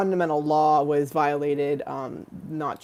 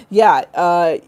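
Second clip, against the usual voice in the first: ordinary talk, nothing marked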